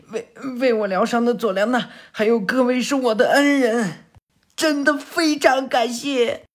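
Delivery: put-on high, hurried, stammering grateful boy; timid underling. Defeated lackey thanking Sarada for healing him, high nervous voice.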